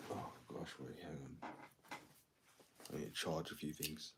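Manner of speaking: low, hushed, half-whispered; quiet murmur to the stream. Real, not acted. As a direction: keep it down so no one wakes. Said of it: "Why is your voice so deep?".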